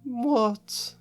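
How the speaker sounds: sulky. Acted. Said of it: "she said sulkily".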